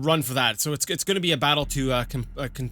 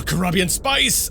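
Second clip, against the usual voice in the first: drawn-out excited exclamation, rising at the end; thrilled discovery, stretched out slowly; 4 semitones higher, 5 dB louder, slower than usual. Real, not acted. Spots a rare resource.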